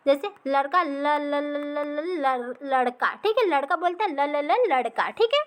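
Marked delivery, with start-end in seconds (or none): 0.9-3.1 stuck repeating ल before getting out लड़का; stuttering demo. Shown as a demonstration. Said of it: "ये आपका stuttering होता है". 4.1-5.1 mock stammer on the first sound of लड़का; stuttering demo. Shown as a demonstration. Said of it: "ये आपका हो गया स्टटरिंग".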